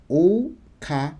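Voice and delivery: "Oka-a-san" with each a drawn out; "Oka-a-san" spelled out slowly, vowel by vowel; "Oka-a-san" with the long a stretched and split. Shown as a demonstration. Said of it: "the way to write that in hiragana".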